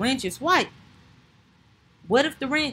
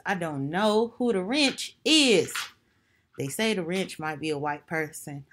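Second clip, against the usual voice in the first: normal talking voice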